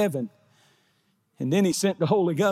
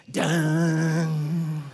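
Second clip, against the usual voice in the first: long held "dun"; imitated band hit. Preacher playing the church band, held instrument hit. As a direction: sound the band's chord with your voice. Mimicking the band between sung lines.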